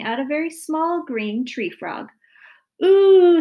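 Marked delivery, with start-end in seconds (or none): 2.8-3.4 high, loud drawn-out "ooh"; loud squeal. A narrator's reading. Daughter recoiling from brother's frog, loud squeal. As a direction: squeal in disgust. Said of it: "Sally squealed".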